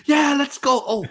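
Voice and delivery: high, loud mock pain cries; hammed-up hurt wrestler. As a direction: ham up a hurt comeback. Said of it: "He's like".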